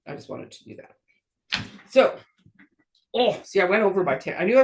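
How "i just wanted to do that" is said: whisper. Real, not acted. Aside after going over time.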